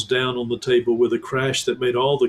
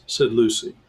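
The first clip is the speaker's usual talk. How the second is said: plain reading voice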